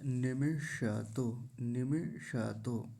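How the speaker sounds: 'निमिष तो' slowly, ni-mi-sha-to; short i's, whole 'sha' in 'निमिष तो'; full ष. Shown as a demonstration. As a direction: keep the vowel on ष in 'निमिष तो'. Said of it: "मूर्धन्य शकार को पूरा बोलेंगे".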